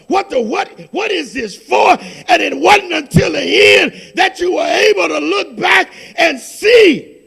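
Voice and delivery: loud, fervent pulpit proclamation; preaching at full force. Acted. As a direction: thunder the scripture over the congregation. Preaching build.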